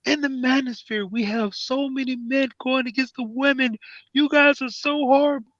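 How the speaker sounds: high, whiny mock pleading; high mock-earnest pleading voice; mocking a simp. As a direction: whine it up like a preachy simp defending women. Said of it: "I was like".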